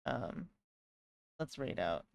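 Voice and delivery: drowsy, low and trailing; half-asleep mumble; nodding off. Real, not acted. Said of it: "I'm really sleepy right now".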